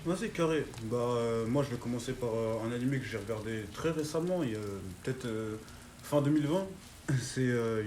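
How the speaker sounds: normal talking voice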